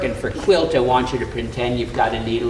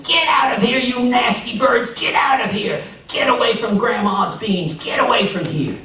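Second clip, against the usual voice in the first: high, loud shouts at the birds; yelling; 9 semitones higher, 3 dB louder than usual. Acted. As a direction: shoo the birds away, yelling.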